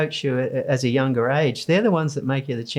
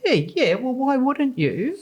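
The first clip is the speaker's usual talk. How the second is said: breezy eager; 9 semitones higher than usual. Retold or imitated. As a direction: shrug it off brightly, higher pitched. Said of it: "I said".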